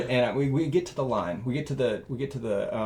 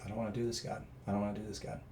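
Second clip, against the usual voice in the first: quiet reluctance; 11 dB quieter, much faster than usual. Retold or imitated. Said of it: "I just was like".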